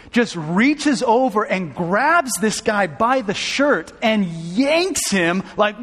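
ordinary delivery

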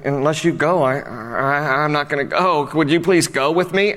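hesitant, stammering plea; passive, weak. Acted. Preacher voices Barak begging Deborah to come along, weak and hesitant. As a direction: play him unsure, pleading for company. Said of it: "if you read it with that tone".

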